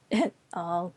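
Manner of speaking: ordinary talk, nothing marked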